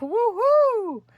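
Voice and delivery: high barking woo-hoo; dog bark. Acted. The daunted dog barks a high woo-hoo. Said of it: "who barked".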